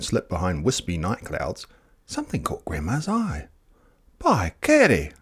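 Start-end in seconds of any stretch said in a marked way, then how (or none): 4.2-5.1 a sharp startled exclamation; exclaimed. A narrator's reading. Grandma startled by the night sky, exclaiming. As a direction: blurt it out in surprise. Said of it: "she exclaimed".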